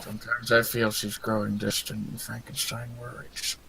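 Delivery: low, soft confiding; subdued, downcast Frankenstein's monster; worried monster. Acted. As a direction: confess it quietly like a troubled creature.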